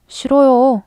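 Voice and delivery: high, loud defiant cry; defiant refusal. A narrator's reading. Boy refusing the head monk's summons, loud defiance. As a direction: refuse with all your force.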